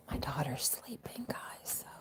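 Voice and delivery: hushed so the daughter sleeps on; soft unvoiced whisper. Real, not acted. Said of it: "I'm gonna whisper for a second".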